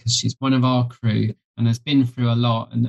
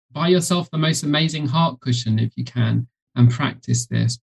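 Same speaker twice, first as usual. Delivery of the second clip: usual speaking voice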